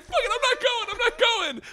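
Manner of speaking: high, loud panicked re-enactment; freaking out. Retold or imitated. Terrified skydiver clutching the sound guy, freaking out. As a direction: squeal a comic, frantic refusal. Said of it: "I fucking freak out".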